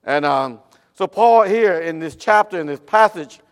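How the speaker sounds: loud, high preaching. Acted. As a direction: preach it with full force. Sermon at full force.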